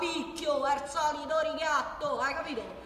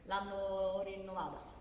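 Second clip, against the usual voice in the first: ordinary talk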